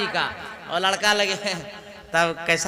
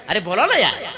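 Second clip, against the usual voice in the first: ordinary talk, nothing marked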